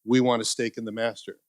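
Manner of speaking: usual speaking voice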